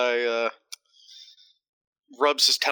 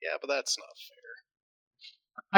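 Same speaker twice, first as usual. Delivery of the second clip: whisper; much slower than usual. Real, not acted. Whispered protest.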